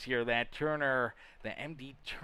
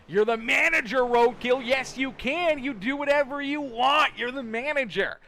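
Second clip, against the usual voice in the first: teasing push; 8 semitones higher, 5 dB louder than usual. Real, not acted. Egging on viewer to race.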